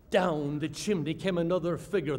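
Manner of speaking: ordinary delivery, nothing marked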